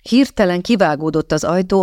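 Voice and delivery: plain reading voice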